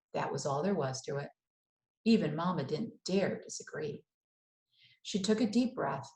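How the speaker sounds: plain reading voice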